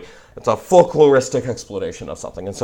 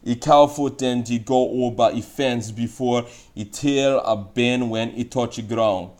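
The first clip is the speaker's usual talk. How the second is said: broad, strong Jamaican patois reading; hard Jamaican patois; 3 semitones higher than usual. Shown as a demonstration. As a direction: lay on a thick Jamaican patois. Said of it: "a hard-born Jamaican patois".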